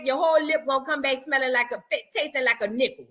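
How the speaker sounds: normal talking voice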